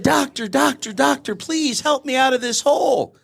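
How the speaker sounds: high, pleading voice calling up from the hole; pleading man in hole. Retold or imitated. Man stuck in a hole begs passing doctor, high pleading. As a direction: beg the doctor for rescue, pitched high.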